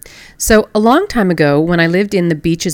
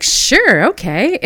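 high, louder, smiley agreement; bubbly and nice; 7 semitones higher, 4 dB louder, much slower than usual. Retold or imitated. Flight attendant agreeing to a pillow request, bubbly. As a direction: gush it as an imitated sweet attendant. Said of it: "she's all bubbly and smiley and really nice".

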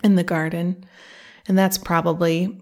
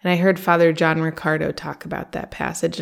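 normal talking voice

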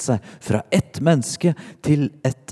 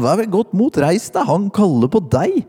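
high, raised calling voice; calling over; 9 semitones higher, 3 dB louder than usual. A narrator's reading. Crowd to the blind man, calling out in a high voice. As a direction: lift the pitch and call to him. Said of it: "De ropte på den blinde".

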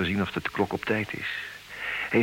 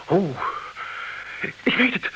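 frantic; 10 semitones higher, 5 dB louder than usual. Acted. Charles in frantic haste.

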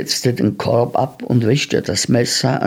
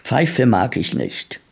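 ordinary delivery, nothing marked

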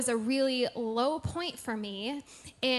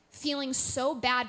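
normal talking voice

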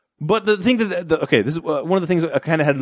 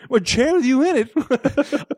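slow, much higher mocking echo; mocking retort; 13 semitones higher, much slower than usual. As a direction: echo it back in slow disbelief.

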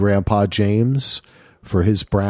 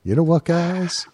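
half-hearted co-host imitation, pitched higher; lightly put-on higher voice; Brett impersonation; 7 semitones higher than usual. Host teasing co-host back on mic, half-hearted impersonation. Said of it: "I'm gonna do my Brett impersonation".

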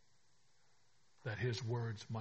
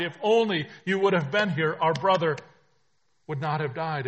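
louder, higher, desperate repeat; the second take climbs higher and louder than the first; pleading sisters; 5 semitones higher, 14 dB louder than usual. Acted. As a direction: push the plea to its peak. Sisters repeat plea, rising.

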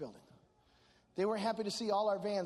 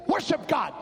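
sharp, louder fatherly command; 6 semitones higher, 9 dB louder than usual. Retold or imitated. Dad orders his church back to worship, commanding. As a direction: imitate Dad ordering the congregation. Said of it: "my dad commanded us".

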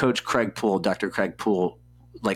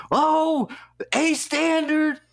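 much higher, slow, drawn-out excited praise; impressed exclamation; much slower than usual. Retold or imitated. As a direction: stretch it out like a proud friend. Lex impressed by the result.

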